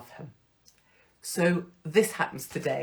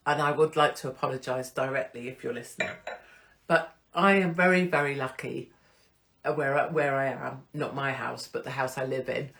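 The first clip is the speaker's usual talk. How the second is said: usual speaking voice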